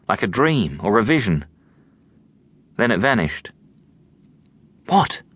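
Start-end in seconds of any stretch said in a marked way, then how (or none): none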